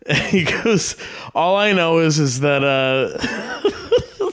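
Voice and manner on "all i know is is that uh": breaking up mid-quote, slowed; can't get the line out for laughing. Real, not acted. Retelling a comic's joke to friends, laughing.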